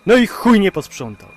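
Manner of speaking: loud, high angry curse; furious outburst. Acted. Driver cursing the passenger who left a mess, loud and furious. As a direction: explode at the mess left behind. Driver alone finds snotty tissues.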